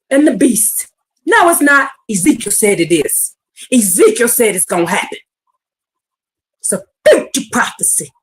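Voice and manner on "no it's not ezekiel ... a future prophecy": usual speaking voice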